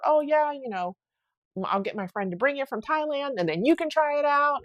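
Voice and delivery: a hint of the friend offering eagerly; mild imitation of an eager lady. Quoting the lady's generous offer.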